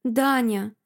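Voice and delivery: ordinary delivery, nothing marked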